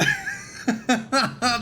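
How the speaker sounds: squeaky panicked giggle; high nervous laugh. Real, not acted. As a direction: laugh in disbelief. Chaos under fire.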